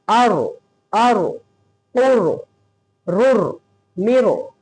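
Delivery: "आरो, ओरो, रुर" with a full, thick r; heavy ra. Shown as a demonstration. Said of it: "मोटा पढ़ेंगे, इस तरह".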